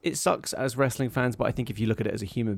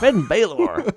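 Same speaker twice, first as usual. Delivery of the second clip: higher, louder drawn-out name call; higher mock William Regal voice; William Regal impression; 9 semitones higher, 5 dB louder, much slower than usual. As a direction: croon the name like a grand old announcer. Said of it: "William Regal".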